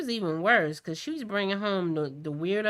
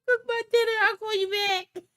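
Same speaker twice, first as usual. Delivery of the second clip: higher, louder, mocking brush-off; mocking dismissive repeat; 14 semitones higher, 4 dB louder than usual. Mother mocking her own phone excuse to the daughter, higher and louder. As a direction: toss the excuse back with a laughing sneer. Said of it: "I'm like".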